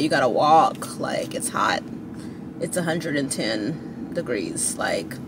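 whispered aside. Real, not acted. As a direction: breathe it as a secret aside. Aside about hot walk.

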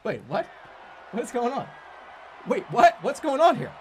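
loud, high startled outburst; startled shout at the game; real surprise. Real, not acted. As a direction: caught off guard, loud and high.

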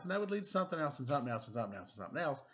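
tumbling fast through the list, gaining speed. Acted. Chain of distractions speeding up.

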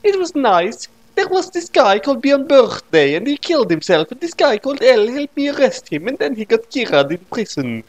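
put-on high, girly voice for Noami; Noami's voice. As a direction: chirp it up high like a young woman. Noami answers Ray in quote.

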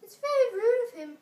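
high, loud sulky complaint; the moon pouting about the sun. A narrator's reading. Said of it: "shining sulkily".

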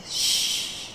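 a soft whispered shh; secretive unvoiced shh; hushing whisper. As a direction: hush them in a whisper. Madman hushing everyone.

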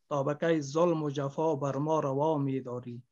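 loud, slow declamation of a sad love poem; grieving poet reciting to the audience; poem recitation. Acted. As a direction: declaim each line like a lament to the beloved.

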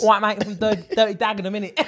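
hammed-up Cockney banter. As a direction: put on an Essex-Cockney geezer. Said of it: "it's more like a Cockney slang".

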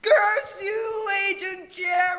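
high, loud furious cry; anguished shout. Acted. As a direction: scream it at the traitor. Betrayal revealed, cries out.

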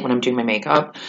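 normal talking voice

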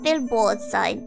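ordinary talk

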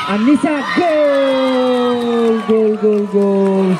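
long drawn-out goal call; goal shout. Real, not acted. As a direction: stretch out the goal yell. Goal call in live commentary.

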